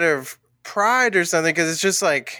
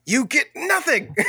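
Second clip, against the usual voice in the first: imitated yelling; 9 semitones higher than usual. Imitating Wonka yelling at Charlie at the movie's end, mock outrage. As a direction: throw the line out like an outraged movie rant. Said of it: "like, he's yelling at Charlie".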